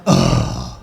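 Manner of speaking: very low, weary groan; annoyed groan. Retold or imitated. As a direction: groan like a tired cop. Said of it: "one of the cops went".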